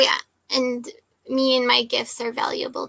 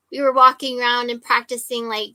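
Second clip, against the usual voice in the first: normal talking voice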